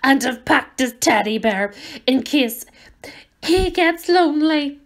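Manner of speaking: choked up big man's farewell; tearful. Acted. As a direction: voice rising into tears.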